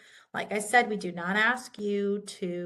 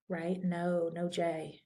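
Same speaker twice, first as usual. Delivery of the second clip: ordinary talk, nothing marked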